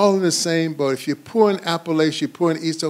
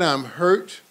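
ordinary talk

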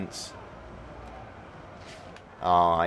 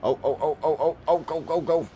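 quick, higher-pitched string of oh's and go's; urgent hurry; 7 semitones higher, faster than usual. Real, not acted. Player rushing through the game, urgent. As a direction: urge them on in a rush. Urging on during gameplay.

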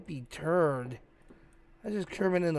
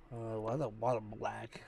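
whisper; 5 semitones lower, 7 dB quieter than usual. Real, not acted. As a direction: breathe it out unvoiced, spooked. Creepy dark game room.

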